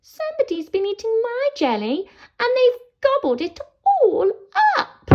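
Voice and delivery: squeaky upset little troll; Baby Troll. Acted. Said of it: "And Baby Troll says".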